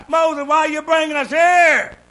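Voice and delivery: high, loud cry to Moses; the frightened people yelling; panicked holler. Retold or imitated. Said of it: "began to holler and cry".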